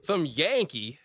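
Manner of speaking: southern disbelief, lightly voiced; southern accent. Streamer keeps southern bit going, mild southern accent. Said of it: "with a southern accent".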